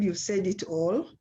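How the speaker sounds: ordinary delivery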